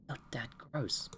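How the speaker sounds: put-on whispered teen cringe at dad; whispered gross-out. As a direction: mutter it embarrassed under the breath. Kid embarrassed by parents.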